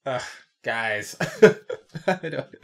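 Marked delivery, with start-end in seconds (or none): 1.2-2.0 loud amused laugh; real laugh; high burst of laughter. Real, not acted. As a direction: crack up. Laughs after Beth impression.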